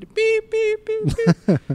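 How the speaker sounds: high beeping censor bleeps; bleep sound. Retold or imitated. Mimicking a TV censor bleep over swear words, high. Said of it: "poner aquí el pic para tapar las palabras malas".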